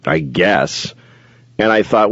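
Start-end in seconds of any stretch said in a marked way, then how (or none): 0.1-0.9 low, half-hearted shrug of a reply; indifferent teacher. Retold or imitated. Teacher answering a parent about water, low and indifferent. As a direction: imitate a teacher who couldn't care less.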